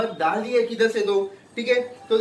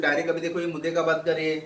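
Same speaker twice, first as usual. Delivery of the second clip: normal talking voice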